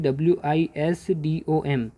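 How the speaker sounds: slow letter-by-letter spelling of विजडम; spelled out. Shown as a demonstration. Teacher spelling विजडम for her students, slow and letter by letter. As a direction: spell it out one letter at a time, slowly. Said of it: "विजडम की spelling क्या होती है".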